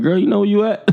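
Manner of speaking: high, laughing sassy jab; mock sassy. Imagining a jab at the woman, sassy and high. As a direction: tease her with a laughing high voice. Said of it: "I'd have been like".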